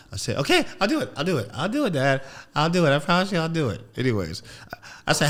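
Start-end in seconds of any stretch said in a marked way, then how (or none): 0.4-3.8 put-on high, laughing little kid promising; squeaky kid voice cracking with laughter; high scared kid. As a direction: hurriedly promise dad like a scared child.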